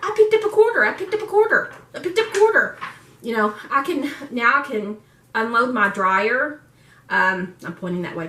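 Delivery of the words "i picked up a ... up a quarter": much higher, louder triumphant chant; excited cheer. Retold or imitated. Telling viewers her quarter victory, thrilled high chant. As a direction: celebrate the little win out loud. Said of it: "and I was like".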